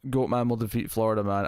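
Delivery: ordinary talk, nothing marked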